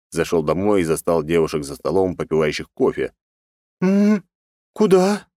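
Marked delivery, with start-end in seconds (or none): 3.8-5.3 sleepy voice. Acted. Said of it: "сонным голосом спросила".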